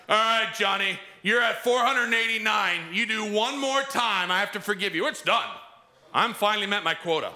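high, mocking voice counting forgiveness; mock a forgiver counting down to the limit; mock tally-keeper. Mock Jesus keeping count.

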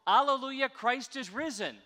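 joyful Easter shout; exclamation mark. Shown as a demonstration. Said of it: "Remembering it's an exclamation mark".

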